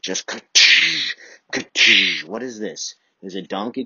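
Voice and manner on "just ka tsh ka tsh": breaking noise. Shown as a demonstration. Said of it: "I'm breaking this shit".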